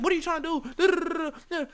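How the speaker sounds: high mocking impression of the loudmouth. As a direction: parody his whining. Mocking the other guy's rant.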